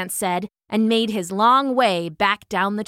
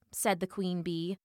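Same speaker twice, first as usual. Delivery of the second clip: usual speaking voice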